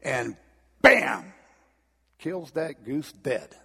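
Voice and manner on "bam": sudden loud high bang; bam. A narrator's reading. Preacher acting the stone hitting the goose, explosive bam. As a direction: punch it out like an impact.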